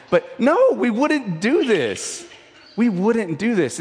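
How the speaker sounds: high, loud and insistent; emphatic no. Real, not acted. Pastor to his congregation, high and emphatic denial. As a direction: drive the no home, slowing on do this. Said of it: "emphatic denial to congregation".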